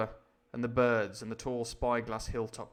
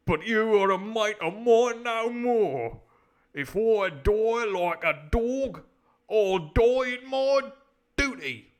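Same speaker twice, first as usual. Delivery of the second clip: loud, slow, high dramatic declaiming; drawn-out, booming pirate-story defiance; defiant sailor; 7 semitones higher, 9 dB louder, slower than usual. Acted. Sailor renouncing his mate, loud and defiant.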